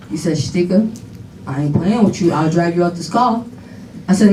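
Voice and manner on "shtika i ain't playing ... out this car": low-pitched dad warning, kept understated; retold no-nonsense warning, pitched down; imitated warning. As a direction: play a father's low, contained threat. Father warns child in story.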